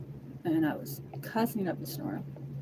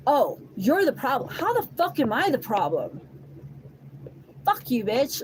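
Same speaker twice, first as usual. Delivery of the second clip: raised, heated mock insults; retold fight, a notch louder; angry retold insults; 6 semitones higher, 4 dB louder than usual. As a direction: spit the insults back, higher and louder. Retelling angry phone fight.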